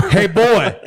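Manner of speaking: lightly put-on Southern accent. Imitating somebody from the South saying it, Southern accent. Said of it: "somebody from the South says it".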